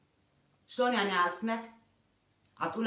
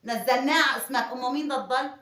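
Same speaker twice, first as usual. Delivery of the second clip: higher-pitched, heated outburst; angry challenge; 4 semitones higher, 8 dB louder than usual. Acted. Wife confronting husband over putting the boy in his name, angry and incredulous. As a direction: fire indignant questions at him, voice raised.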